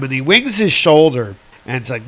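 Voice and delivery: usual speaking voice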